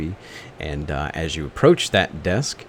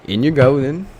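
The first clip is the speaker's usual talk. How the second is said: louder, higher guard's okay; gruff guard voice; 8 semitones higher, 9 dB louder than usual. Acted. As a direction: wave them through like a bored guard.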